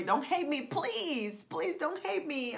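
high-pitched mock begging; playful pleading. Writer apologizing for beating writer's block, playful high pleading. Playfully begs listeners after boasting.

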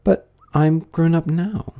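a touch of sorrow, drawn out; sorrowful. A narrator's reading. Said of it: "added in a sorrowful tone".

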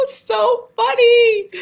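squealed laugh, drawn-out words; high laughing delight. Real, not acted. Teacher tickled by the silly gorilla song, laughing.

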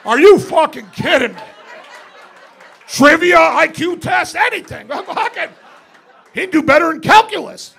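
loud, high laughing outburst; incredulous rant. Retold or imitated. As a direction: rant in disbelief. Ranting at drug test news.

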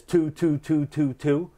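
"two" repeated as an even series; tonguing syllable demo. Shown as a demonstration. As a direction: say "two" with an oo vowel, one after another. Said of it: "it says the pronunciation".

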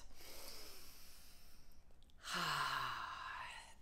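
long, soft, falling sigh out. Shown as a demonstration. Yoga leader showing the class deep breaths, long soft sigh. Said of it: "Take in some good deep breaths".

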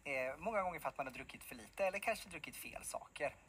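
ordinary talk, nothing marked

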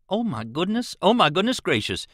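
acted high-pitched exclamation of astonishment; excited yell. A narrator's reading. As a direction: cry out in rising excitement. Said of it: "yelled Jim just then".

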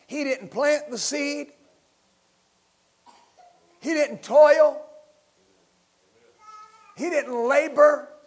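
loud, high, rousing pulpit preaching; fiery sermon climax building to a shout; preaching at full force. Acted. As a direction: hammer each line home to the church.

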